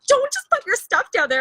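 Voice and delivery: a friend quickly warning. Retold or imitated. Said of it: "my friend Shelby was like".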